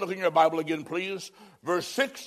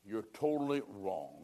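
hushed; 9 semitones lower, 10 dB quieter than usual. Acted. Rebuttal to imagined objector.

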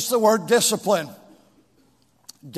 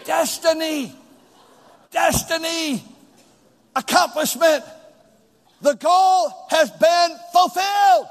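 building shout; 5 semitones higher, slower than usual. Real, not acted. Preacher building to climax.

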